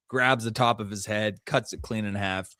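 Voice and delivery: normal talking voice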